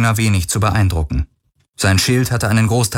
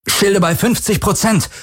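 high, strained call over the din; grinding out over noise; 11 semitones higher than usual. A narrator's reading. Pilot reporting shield damage over the impacts, strained call. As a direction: report it tensely above the noise. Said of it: "knirschte er über den Lärm der Einschläge".

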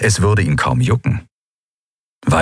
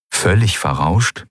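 ordinary delivery

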